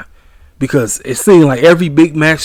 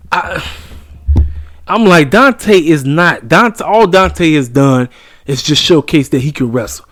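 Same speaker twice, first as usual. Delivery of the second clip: usual speaking voice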